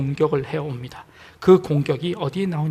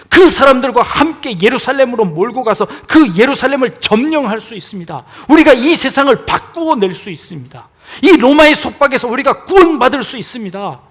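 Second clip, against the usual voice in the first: loud, higher, heated protest; rebuking outburst; 5 semitones higher, 11 dB louder than usual. Acted. Peter rebuking Jesus for speaking of death, heated shout. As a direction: Peter scolds his master in earnest. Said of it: "꾸짖어서 이야기합니다".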